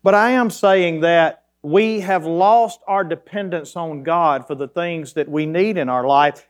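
ordinary talk, nothing marked

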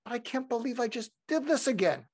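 high-pitched mock dismay; self-reproach. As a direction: fret over repeating your own mistake. Acts out beating himself up.

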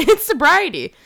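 laughing. Real, not acted. Laughing echo of partner.

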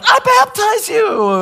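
high, slow child playing preacher; pretend-pastor child voice; mock solemn kid. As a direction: pronounce it grandly like a kid pretending. Kid playing pastor in hot tub.

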